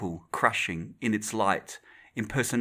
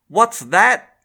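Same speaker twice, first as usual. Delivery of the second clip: louder, higher, hard into the mouthpiece; landlady's accent changing as she presses the phone; blunt landlady; 10 semitones higher, 11 dB louder than usual. Acted. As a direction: drop the gentility and ask straight. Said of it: "truthfulness changed her accent, hard on the mouthpiece".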